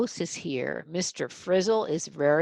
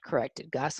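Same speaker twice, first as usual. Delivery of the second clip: usual speaking voice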